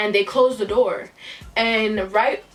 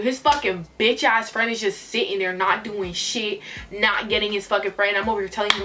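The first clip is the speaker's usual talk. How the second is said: ordinary talk, nothing marked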